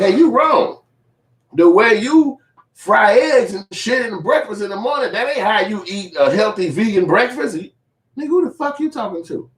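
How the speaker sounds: very high, loud, prissy mock scold. Corey criticizing his breakfast, high hammed-up scold. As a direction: nag like a fussy critic. Mocking Zoe's accuser.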